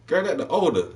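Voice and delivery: put-on a high, gossipy "Girl"; high put-on bartender voice. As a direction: lean in with a high knowing girl voice. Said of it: "She's like".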